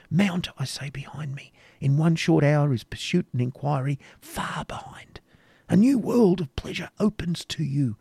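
a passionate knight tempting her to flee with him; animated. A narrator's reading. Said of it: "proceeding with animation".

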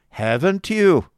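loud, high, drawn-out stern challenge. A narrator's reading. Schoolmaster rounding on his impatient wife, stern. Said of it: "said Squeers sternly".

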